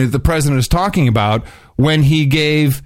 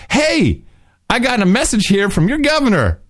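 mock excited hey; 7 semitones higher than usual. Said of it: "I immediately went".

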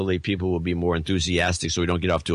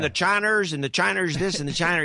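lightly voiced Texas politician; Rick Perry impression; 9 semitones higher than usual. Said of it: "he couldn't pronounce China".

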